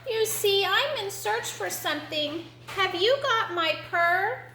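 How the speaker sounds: high little kitten voice; high kitten, rising question; coaxing kitten. Acted. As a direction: explain and ask as a small kitten.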